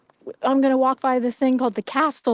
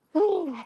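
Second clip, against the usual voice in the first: relieved exhale; 7 semitones higher than usual. Real, not acted. Exhale after climbing.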